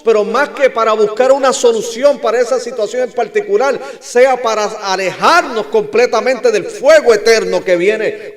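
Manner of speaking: high, loud pulpit delivery; full-force preaching. Acted. Preacher warns congregation of eternal fire, full-force preaching.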